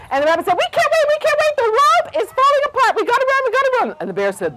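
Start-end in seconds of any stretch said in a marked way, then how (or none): 0.6-4.0 very high, frantic squeal; panicked rabbits. A narrator's reading. Rabbits answering the bear as they flee, panicked squeal. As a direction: squeal it as if fleeing.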